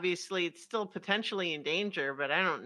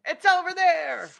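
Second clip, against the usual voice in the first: loud in-character warning shout; 10 semitones higher, 9 dB louder than usual. Acted. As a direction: call it out to the party. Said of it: "I will shout".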